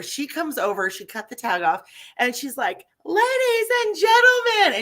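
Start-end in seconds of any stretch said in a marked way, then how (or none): 3.1-4.8 high, loud mock announcer call; big hammed-up proclamation, drawn high; imitated screaming announcement. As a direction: holler it like introducing a star to the crowd. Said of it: "and she's screaming".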